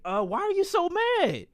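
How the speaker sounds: high-pitched, puzzled question; bewildered innocence. Retold or imitated. Asking his angry sisters, innocently bewildered. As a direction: go high, all innocent confusion.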